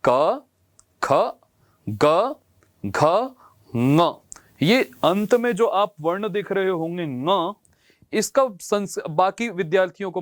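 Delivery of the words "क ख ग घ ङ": slow, separated क ख ग घ ङ; teacher demonstrates each consonant; reciting consonants. Shown as a demonstration. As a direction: clear consonant recital for pupils. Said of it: "फिर से मैं बोलता हूं".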